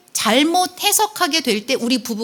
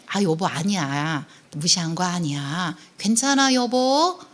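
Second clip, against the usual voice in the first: softer, coaxing wife's voice; acted soothing; 4 semitones lower, 4 dB quieter than usual. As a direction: soothe an upset husband, lingering on the words. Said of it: "다독다독 안아주잖아요". (다독이듯 부드럽게)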